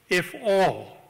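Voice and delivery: "all" leaned on, drawn out for weight; reading the code book, pressing on "all"; stress on all. Shown as a demonstration. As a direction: hit the word "all" like a condition. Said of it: "I emphasize the word all".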